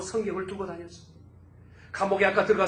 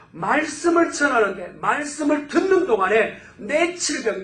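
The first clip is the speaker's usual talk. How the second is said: loud, high, ringing sermon delivery, pressing each phrase; full-force preaching; 7 semitones higher, 10 dB louder than usual. Acted. Pastor urging the congregation to expect healing, preaching loud and high.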